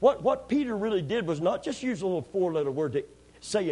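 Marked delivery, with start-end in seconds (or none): none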